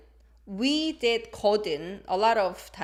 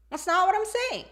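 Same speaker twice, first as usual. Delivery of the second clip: high-pitched, insistent contradiction; confrontational; 10 semitones higher, 3 dB louder than usual. Shown as a demonstration. Teacher voicing the confrontational version, high insistent pushback. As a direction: push back, pitched well above usual.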